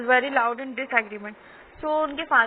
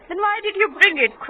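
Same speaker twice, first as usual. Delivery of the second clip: higher, slow, mildly raised voice; mother's cry; 6 semitones higher, 5 dB louder, much slower than usual. A narrator's reading. Mother questioning father about the rabbit, mild cry. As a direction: read it as a mild outcry. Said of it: "cried my mother".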